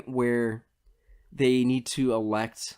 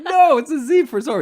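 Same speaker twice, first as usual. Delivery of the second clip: higher, loud, through laughter; laughing correction; 10 dB louder than usual. Retold or imitated. Retelling Zorro's correction, laughing hard. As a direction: insist while cracking up. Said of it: "And he's like".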